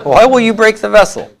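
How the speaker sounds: Why will you break the vessel? asked high, like a real question; mock asking. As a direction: ask it as if scolding someone. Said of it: "sounds like a very typical thing to ask".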